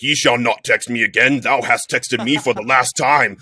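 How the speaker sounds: loud, booming mock-biblical decree; hammed-up archaic pronouncement; King James proclamation. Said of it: "it was in, like, King James".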